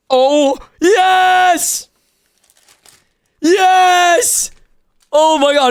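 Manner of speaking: high, elated victory yell; triumphant shout. Real, not acted. Streamer pulls the Dragonair he wanted, thrilled high shouting. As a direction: cry out in overjoyed disbelief.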